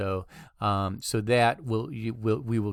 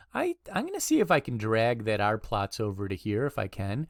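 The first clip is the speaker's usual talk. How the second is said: normal talking voice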